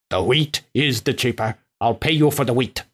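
usual speaking voice

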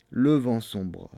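plain reading voice

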